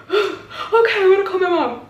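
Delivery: high-pitched mock panic; retold panicky giving in. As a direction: squeak out a reluctant agreement.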